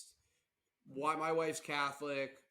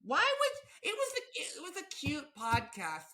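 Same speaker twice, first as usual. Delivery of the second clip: high-pitched, upset mom voice; mock-distraught mom; imitated mother; 6 semitones higher than usual. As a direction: plead in a put-on high voice like a dismayed mother. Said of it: "my mother listens to this and she's like".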